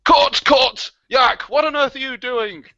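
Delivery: playful fake film director outburst; mock director yelling cut. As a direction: shout cut at the actors like an exasperated director.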